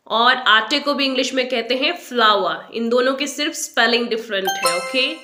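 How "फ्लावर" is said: फ्लावर as FLAU-wa; correct pronunciation. Shown as a demonstration. Teacher equating flower and flour, फ्लावर as FLAU-wa. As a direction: say फ्लावर with w and silent r. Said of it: "लेकिन pronunciation same है".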